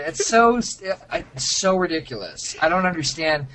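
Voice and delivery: ordinary talk, nothing marked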